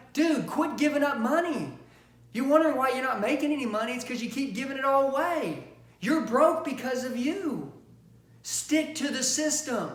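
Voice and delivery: high, loud, emphatic telling-off; exasperated scolding. Real, not acted. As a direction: berate the salesmen, hammering each point home. Coach scolding weak salesmen.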